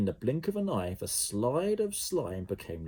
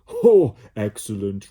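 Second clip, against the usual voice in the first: much louder, slow gleeful blob voice; Slime delighted; 13 dB louder than usual. A narrator's reading. Slime delighted with the plan, loud and slow. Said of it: "replied Slime".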